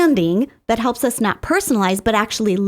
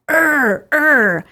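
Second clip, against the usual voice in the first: short, rough mock growls, a bit louder; toddler's growl; 5 semitones higher, 4 dB louder than usual. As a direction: imitate a little kid growling in frustration. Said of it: "and I heard this".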